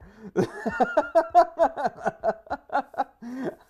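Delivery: a burst of real high laughter; laughing at himself. Real, not acted. Laughing at his own slip to viewers, real high laugh. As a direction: laugh off the flubbed line.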